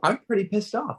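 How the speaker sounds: a fan's heated complaint; pissed off. Real, not acted. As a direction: let the frustration rise in the voice. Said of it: "I'm passionate tonight".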